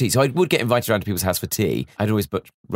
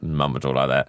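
much lower than the dad's voice, a mock voice for mum; mum's voice; 7 semitones lower than usual. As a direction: drop the mum down low as a send-up. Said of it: "a bit kind of".

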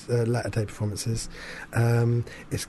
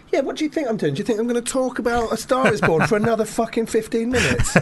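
much higher, laughing through the question; 9 semitones higher than usual. Real, not acted. Host mocking himself to cohost, laughing and much higher. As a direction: crack up at your own rambling. Laughs at own long ramble.

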